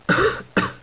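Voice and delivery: small high sick cough. Acted. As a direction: cough weakly.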